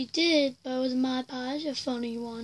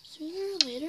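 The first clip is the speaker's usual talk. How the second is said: ordinary talk, nothing marked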